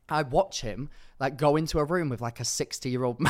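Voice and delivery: normal talking voice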